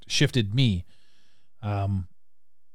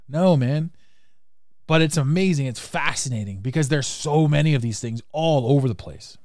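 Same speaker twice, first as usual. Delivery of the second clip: usual speaking voice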